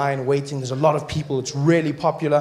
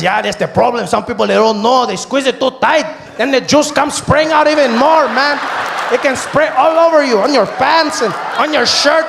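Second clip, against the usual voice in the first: very high, loud warning shout; a high, loud yell about juice spraying; taco guy; 10 semitones higher, 8 dB louder than usual. Retold or imitated. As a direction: warn him like a vendor who has seen it. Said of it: "He says".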